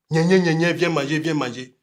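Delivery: mock the squawked call to dinner; mocking parrot. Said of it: "on dirait un perroquet". (imitation moqueuse, façon perroquet)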